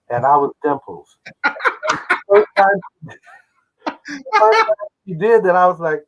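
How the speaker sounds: ordinary talk, nothing marked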